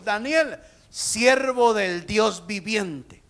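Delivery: a king crying out in anguish; anguished shout. Acted. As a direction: proclaim it slowly at full voice. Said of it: "gritó con angustia".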